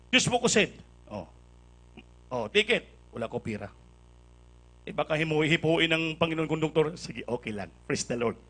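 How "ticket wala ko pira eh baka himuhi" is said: ordinary talk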